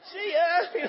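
high, loud joking send-off; comic high-voiced farewell; mock cheery farewell. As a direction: toss off a chirpy goodbye as you drive past.

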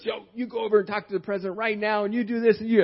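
fired up, pushing him to act now; excited friend. Retold or imitated. Friend urging him to confront the president, excited. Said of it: "I mean, he got excited".

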